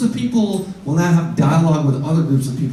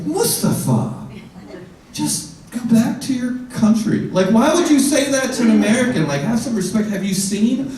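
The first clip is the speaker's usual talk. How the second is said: mock indignant rant; 9 semitones higher than usual. Mocking rant at Mustafa.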